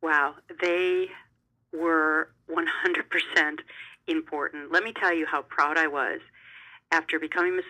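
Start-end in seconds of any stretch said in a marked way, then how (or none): 1.7-4.7 one hundred percent drawn out slowly; emphatic. Real, not acted. Stressing how vital the scholarships were, slow emphasis. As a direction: lean on each word of one hundred percent important. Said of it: "I can't stress it enough".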